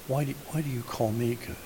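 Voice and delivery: soft, tender question; lovingly. Shown as a demonstration. Jesus answering the man who knelt before him, lovingly. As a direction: ask it gently, with love. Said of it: "said it lovingly".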